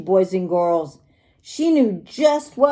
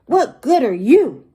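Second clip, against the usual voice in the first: louder, grumpy glowering question; glowering challenge; 3 semitones higher, 5 dB louder than usual. A narrator's reading. Grumpy pirate confronting his new parrot, glowering challenge. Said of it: "Gus glowers at the parrot".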